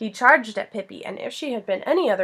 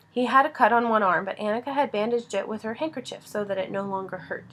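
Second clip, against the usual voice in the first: ordinary delivery, nothing marked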